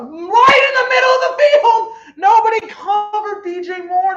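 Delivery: very high, loud, cracking touchdown call; real excited scream. Real, not acted. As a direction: scream the call as the receiver breaks open.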